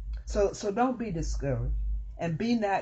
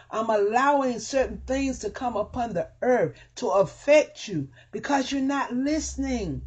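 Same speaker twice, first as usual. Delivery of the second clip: high, loud voice of God calling his people; God pleading; 7 semitones higher, 4 dB louder than usual. Acted. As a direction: plead as God with open arms. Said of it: "So he's saying".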